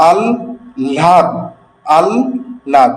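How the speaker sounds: আহ্লাদ slowly as allad, double l, no h; correct pronunciation, slow. Shown as a demonstration. Teacher drills students on the right form, very slow. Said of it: "হ্যাঁ, আহ্লাদ নয়".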